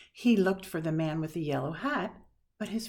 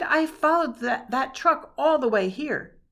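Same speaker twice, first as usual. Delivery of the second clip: excited outcry; 7 semitones higher, 9 dB louder than usual. A narrator's reading. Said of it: "he cried".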